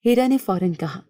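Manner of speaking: plain reading voice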